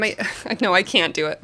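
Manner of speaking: usual speaking voice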